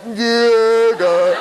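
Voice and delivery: drawn-out, reluctant waking groan; groggy groan. Acted. Speaker shows how he really wakes up, acted weary groan. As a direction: groan it like you just got up. His real groggy morning reaction.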